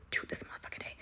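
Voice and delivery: hushed, exasperated muttering; whispered rant. Real, not acted. Ranting about the heroine's name, whispered in disbelief.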